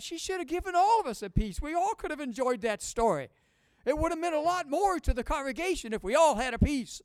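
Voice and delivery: high, loud mock protest; hammed-up grievance, pitched well up; mock complaint. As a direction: playfully plead the case for a treat. Acts out his mock complaint.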